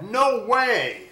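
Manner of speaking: loud, high laughing no; mocking refusal. A narrator's reading. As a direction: scoff it down at him. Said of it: "He laughed and said".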